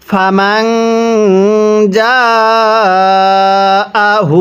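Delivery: ফামাং with a long humming nasal; nasal ghunnah. Shown as a demonstration. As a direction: hold the ং nasal, then very slow জাআহু. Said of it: "নুন সাকিনের মধ্যে গুন্নাহ করব".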